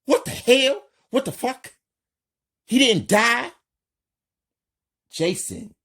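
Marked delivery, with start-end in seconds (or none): 0.0-3.5 high furious yell; shocked, outraged yelling. A narrator's reading. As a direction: scream it out in shock and rage. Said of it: "Robert yells".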